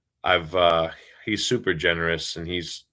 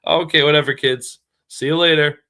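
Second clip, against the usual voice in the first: higher, louder playful brush-off; mock dismissal; 7 semitones higher, 7 dB louder than usual. Teacher brushing off unimpressed students, mock dismissal. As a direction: wave the kids off in jest, voice raised.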